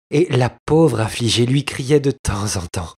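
usual speaking voice

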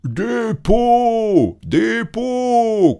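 loud, very high drawn-out calls; street vendor's cry. A narrator's reading. Grand Claus still hawking hides, loud high vendor's cry. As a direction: holler it to the whole street. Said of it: "continua-t-il".

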